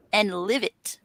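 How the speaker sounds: ordinary talk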